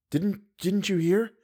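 stammering with fear; frightened whisper. A narrator's reading. To Raoul, a terrified girl hearing a sigh. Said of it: "Her teeth chattered".

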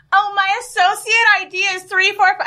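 high put-on eager clerk voice; mock her pushy eagerness; imitating the saleswoman. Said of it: "Anushka goes".

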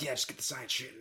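soft, near-whispered mumble; muttering. Retold or imitated. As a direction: mumble the science under your breath.

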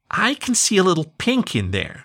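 put-on high little girl stating; matter-of-fact small child. A narrator's reading. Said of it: "she stated".

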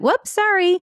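mock chirpy brush-off; mocking flippant apology. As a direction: mimic the lawyer shrugging off a serious mistake. Lawyer shrugs off the wrong inmate.

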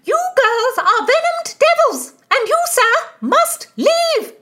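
very high, loud outraged shriek. A narrator's reading. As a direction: scream the order at them. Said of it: "shrieked Mistress Aglynsby".

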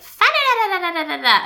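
high nonsense babbling; toddler babble. Retold or imitated. Mom mimicking her daughter's babbling, high. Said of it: "it's just like".

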